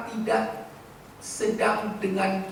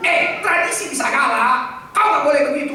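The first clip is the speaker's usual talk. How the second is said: grandparent's warning; 6 semitones higher, 9 dB louder than usual. Retold or imitated. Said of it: "Opung kita bilang".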